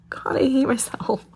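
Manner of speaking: laughing whisper through tears; tearful whisper. Real, not acted. Tearful whisper, scolding herself on her podcast. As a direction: breathe it out, embarrassed at crying. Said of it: "I can't believe I'm fucking crying".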